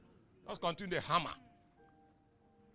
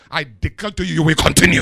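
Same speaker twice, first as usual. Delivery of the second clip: much louder preacher's proclamation; shouted declaration; 3 semitones lower, 18 dB louder than usual. Real, not acted. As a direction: declare it forcefully for an amen. Declaration to congregation, answered amen.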